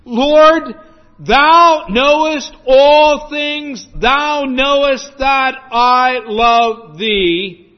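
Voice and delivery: high, loud, slow and half sung, pleading; intense plea. Acted. Grieved Peter cries out his love to Jesus, intense and slow. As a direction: pour the whole heart out, drawn long and high. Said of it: "with the intensity of what I'm saying".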